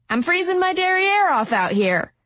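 high, sharp snap; snapping. A narrator's reading. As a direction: snap at her impatiently. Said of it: "she snapped".

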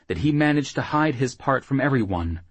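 ordinary delivery

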